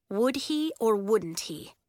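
ordinary delivery, nothing marked

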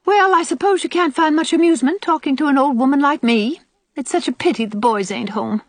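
put-on high, self-pitying old woman; put-upon old aunt. Old aunt to departing girls, reproachful. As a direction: make the guests feel guilty.

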